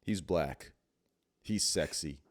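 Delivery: normal talking voice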